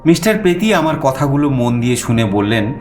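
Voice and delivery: ordinary delivery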